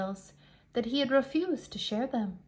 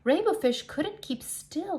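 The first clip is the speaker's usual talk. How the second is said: ordinary delivery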